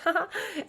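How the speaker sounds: short laugh. Real, not acted. Laughing at own age joke.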